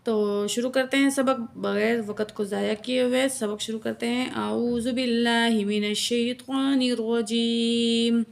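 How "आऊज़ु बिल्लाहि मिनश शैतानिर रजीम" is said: slow, melodic tajweed chanting, each sound stretched; Quran recitation. Shown as a demonstration. Teacher recites verse for her students, slow melodic chant. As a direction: chant the verse slowly and reverently, like a qari.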